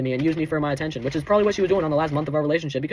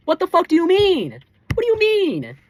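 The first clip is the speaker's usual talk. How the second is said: much higher, louder, slow disbelieving yell; exasperated outburst; 14 semitones higher, 4 dB louder, slower than usual. Real, not acted. Boyfriend yelling in disbelief at his girlfriend, outraged shout. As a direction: blow up in disbelief at her careless answer.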